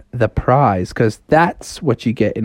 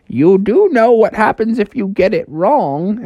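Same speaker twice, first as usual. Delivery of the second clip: high, ominous host's warning; talking house; 8 semitones higher than usual. Acted. House warns Froggy about getting it wrong, theatrically ominous. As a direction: warn them theatrically, pitched up. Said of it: "the house was like".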